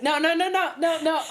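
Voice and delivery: high, loud rapid-fire protest; retold panic, heading him off; mock-frantic string of refusals; panicked refusal.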